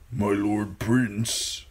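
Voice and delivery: slow, lightly voiced servile tone; servile Reek. A narrator's reading. Reek addressing his prince, slow and lightly servile.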